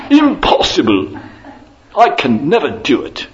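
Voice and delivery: high, loud posh British cry breaking into laughter; an English lord laughing in disbelief; British accent, laughing. Retold or imitated. Retelling Zetland's refusal to the audience, British accent with laughter. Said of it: "in his best British accent".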